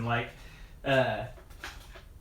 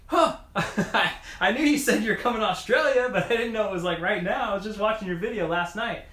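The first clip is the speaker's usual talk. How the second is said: lightly voiced surprised fan, higher pitch; a mild imitation of an amazed guy, pitched up; excited stranger; 8 semitones higher than usual. A fan recognizing him at the shuttle, mildly imitated excited and higher. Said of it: "a guy turned around and was like".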